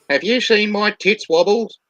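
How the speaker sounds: high, loud quavery granny; old lady voice. Retold or imitated. Old lady asking the desk sergeant about her cat, high granny voice. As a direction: ask it like an anxious little old lady. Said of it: "She says to the desk sergeant".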